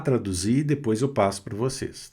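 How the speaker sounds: usual speaking voice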